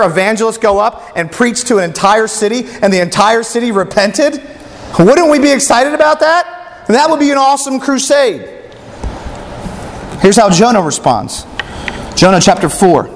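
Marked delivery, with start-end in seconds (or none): 5.0-8.5 higher, rousing question to the crowd; preacher stirring up his listeners, excited. Real, not acted. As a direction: lift them with real excitement.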